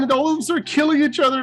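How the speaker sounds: ordinary talk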